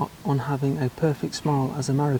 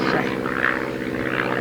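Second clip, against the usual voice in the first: deep mock shame; 13 semitones lower than usual. Mock scolding of viewers.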